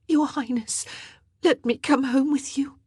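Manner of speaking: high, pleading plea to the queen. A narrator's reading. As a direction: beg to be taken home. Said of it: "she pleaded".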